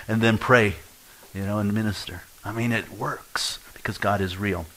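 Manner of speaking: ordinary talk, nothing marked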